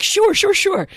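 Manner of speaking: high, loud placating "sure"; high-pitched, over-eager yes; quick-to-agree. Retold or imitated. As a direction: agree brightly to get them off your back. Eager agreement to husband's plea.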